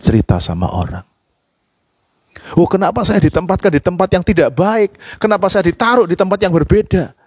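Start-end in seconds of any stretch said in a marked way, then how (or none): 2.5-7.2 high-pitched mock grumble; acted complaining. Preacher imitating his own complaint about his placement, high whiny protest. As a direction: whine it as a protest, higher voice. Acts out complaining before hushing it.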